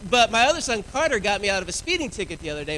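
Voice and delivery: ordinary talk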